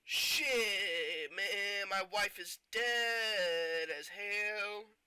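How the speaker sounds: loud, drawn-out cry of mock anguish; hammed-up grief, stretch the words out long and loud; anguished wail. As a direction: wail it like an overacting action hero. Acted anguished cry over dead wife.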